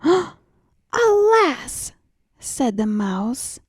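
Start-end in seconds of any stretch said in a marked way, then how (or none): 0.0-1.9 high gasp then a cry of dismay. Acted. Mouse finds the fat pot empty, dismayed. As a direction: gasp as the truth dawns.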